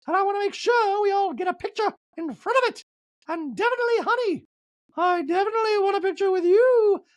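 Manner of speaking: very high, sweet, mock-posh voice; squeaky doting impersonation of Dorit; Dorit impression. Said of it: "and Dorit's like".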